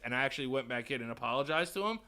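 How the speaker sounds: usual speaking voice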